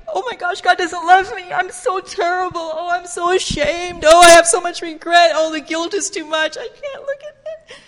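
high, loud mock lament; hammed-up tearful self-pity, breaking into a squeal; guilt-ridden wailing. Preacher mimicking someone crushed by guilt, wailing tearfully. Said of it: "didn't sound like".

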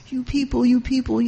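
loud scolding pulpit bark at the crowd; a preacher lecturing from the podium, loud; mock podium preacher. Preacher scolding the people from a podium, loud mock rant. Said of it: "He's not roaring from a big podium like this".

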